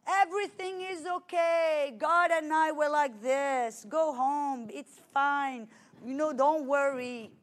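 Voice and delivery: put-on southern French accent, carefree brush-off. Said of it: "in his southern French accent".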